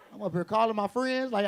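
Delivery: ordinary delivery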